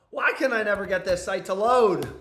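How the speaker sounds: loud, frustrated complaint; loud venting in frustration; exasperated outburst. Real, not acted. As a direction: burst out at a website that won't load. Stuck website, vents at it loudly.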